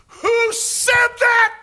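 very high, loud, slow furious scream. Retold or imitated. Khrushchev demanding who heckled him, slow furious scream. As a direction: scream it into the mic, daring anyone to answer. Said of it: "screamed in the mic".

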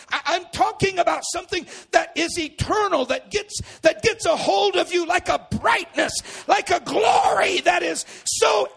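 high, loud preaching shout, slowing on inconceivable; preaching at full force. Real, not acted. Pastor stirred in the Holy Ghost, shouting. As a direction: pour it out to the room.

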